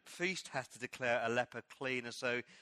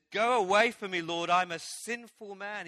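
desperate plea; 5 semitones higher, 7 dB louder than usual. Retold or imitated. Peter on his knees before Jesus, crying out loud. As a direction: beg him to leave you, ashamed.

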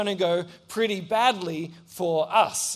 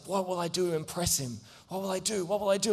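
anxious inner thought; 6 dB quieter, faster than usual. Retold or imitated. Boy panics about impressing the bully, quiet fretting. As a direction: fret under your breath.

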